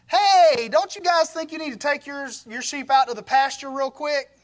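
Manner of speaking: high, loud, hurried nudge to clear them out; eager call to shepherds. Retold or imitated. Jacob shooing the shepherds away, high and loud.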